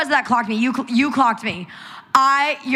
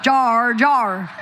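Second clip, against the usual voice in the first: imitated Aussie vowel on "Jar"; Australian accent. Guest repeats their name as Australians say it, mock Australian accent. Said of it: "Y'all say Jo".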